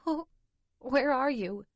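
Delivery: crying for her sister to come; hiccupping sobs. A narrator's reading. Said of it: "she hiccups into the phone".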